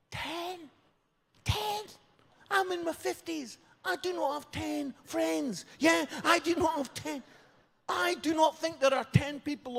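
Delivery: high, squeezed disbelief; aghast. Acted. Father hearing his daughter wants ten bridesmaids, aghast. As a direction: protest in a very high, incredulous voice.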